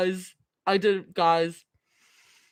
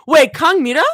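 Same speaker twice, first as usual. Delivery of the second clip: ordinary delivery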